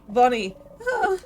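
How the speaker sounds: loud, high wrenching cry; anguished scream. Acted. Hero screaming as Bonnie is killed, anguished. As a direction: scream as the child is lost.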